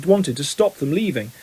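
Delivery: ordinary delivery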